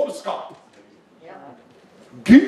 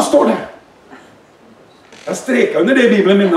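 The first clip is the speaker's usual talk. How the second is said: ordinary delivery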